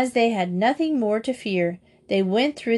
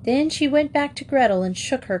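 ordinary delivery

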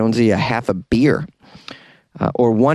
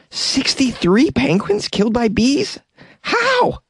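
incredulous; 11 semitones higher, slower than usual. Real, not acted. Disbelief at penguin deaths.

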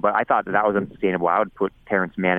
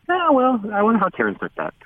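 mock wonder. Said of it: "you're kinda like".

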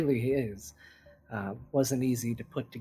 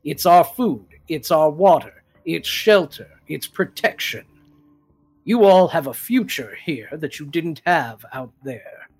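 much louder, forceful lecture; stern scolding leader; 3 semitones higher, 11 dB louder than usual. Acted. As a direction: lay down the law to greedy recruits. Leader scolds guards about pay.

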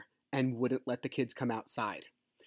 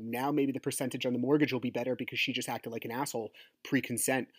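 ordinary delivery

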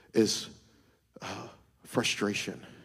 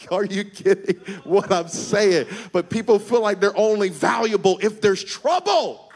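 loud, high, rhythmic preaching with shouted peaks; preaching at full force; 12 semitones higher, 12 dB louder than usual. Real, not acted. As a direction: build the crowd up to a roar.

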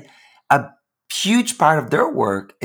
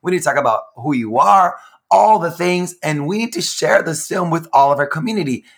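mock demanding excitement; 6 semitones higher, 3 dB louder than usual. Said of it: "And I was like".

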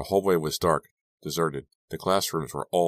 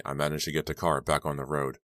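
ordinary delivery, nothing marked

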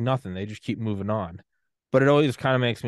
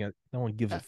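usual speaking voice

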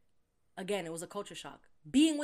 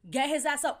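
loud, blunt refusal to share the bed; 8 dB louder than usual. Retold or imitated. Telling Shorty to wake the guy up, loudly. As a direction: push back hard on sleeping with him. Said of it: "And I was like".

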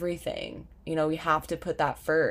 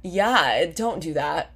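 ordinary delivery

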